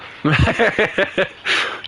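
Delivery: high chuckle; laughing. Real, not acted. Amused by a friend's reply, giggling high.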